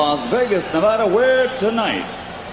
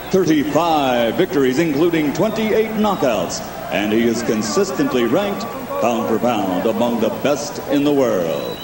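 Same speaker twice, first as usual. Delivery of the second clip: ring announcer; 6 semitones lower than usual. Acted. Ring announcer fighter intro.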